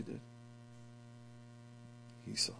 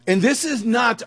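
ordinary talk

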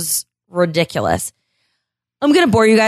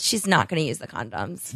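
sarcastic murmur; 7 semitones lower, 10 dB quieter than usual. Acted. Sarcastic aside, much quieter.

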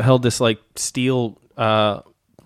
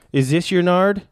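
higher, showy magician-trick ask; 6 semitones higher than usual. Shown as a demonstration. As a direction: ask it like a magician's reveal, pitched up. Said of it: "Opened my mouth and asked".

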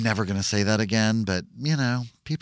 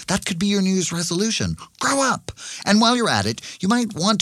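higher and faster, fed up; exasperated scolding; 10 semitones higher, faster than usual. Acted. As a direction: scold him like a child. Scolding Jordan, rising.

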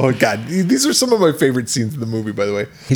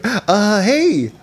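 high hammed-up host; sing-song creepy invitation; mock spooky host; 8 semitones higher than usual. Host invites kids to feel the props, hammed-up spooky. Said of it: "it's like".

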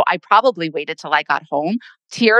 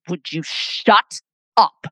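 rude dentist; slower than usual. Retold or imitated. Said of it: "he turns to me and he goes".

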